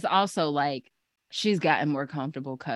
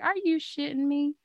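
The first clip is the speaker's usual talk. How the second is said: incredulous; 12 semitones higher than usual. Retold or imitated. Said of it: "my reaction to some shit".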